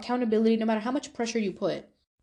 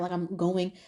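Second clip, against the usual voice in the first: ordinary talk, nothing marked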